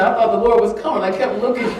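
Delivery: loud, high, through laughter; laughing retelling of husband; laughing while quoting. Retold or imitated. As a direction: quote husband while cracking up. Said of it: "my husband said".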